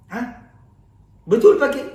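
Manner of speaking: high, loud startled question; shocked disbelief. Acted. Desperate man asks the kiai if he means it, shocked and high. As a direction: gasp it out, not believing the kiai.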